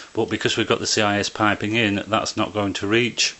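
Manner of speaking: ordinary talk, nothing marked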